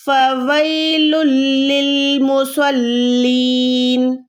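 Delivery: slow melodic tajweed chant; Quran recitation. Acted. Tutor reciting the verse for her student: slow tajweed chant. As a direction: chant the verse slowly and evenly.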